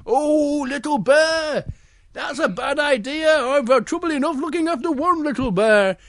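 high, exasperated story bear; Big Bear. Acted. Big Bear dismayed at two cubs.

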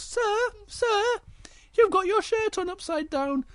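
put-on very high squeaky child; tiny high-pitched pupil; pupil like Little Bear. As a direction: squeak it up to the teacher. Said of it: "he's got the same voice as Little Bear".

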